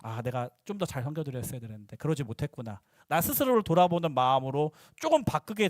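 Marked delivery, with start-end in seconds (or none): 0.0-2.8 low, soft murmur to oneself; hushed, humbled inner voice; apologetic self-reflection. Retold or imitated. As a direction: voice a quiet, regretful realization. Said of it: "죄송한 마음".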